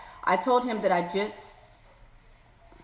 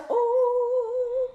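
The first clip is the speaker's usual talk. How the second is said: long high "ooh"; shocked sustained high hoot; scandalized ooh; 13 semitones higher than usual. Real, not acted. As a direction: hold a high, singing ooh. Shocked at the letter.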